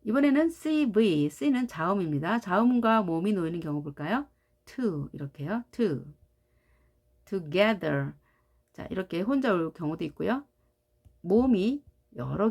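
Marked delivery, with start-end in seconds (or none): none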